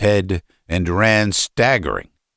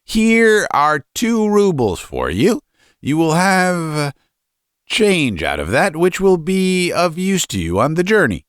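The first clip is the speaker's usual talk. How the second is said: stingy father handing out money; 9 semitones higher than usual. Acted. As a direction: play the father doling out coins, pitched up.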